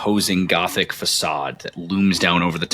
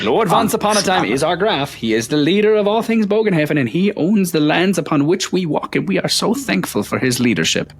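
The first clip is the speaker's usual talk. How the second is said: higher, eager praise for the lord; fawning clerk; 10 semitones higher than usual. Acted. Town clerk praises the Graf to the party, fawning and higher. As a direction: gush like a loyal town clerk.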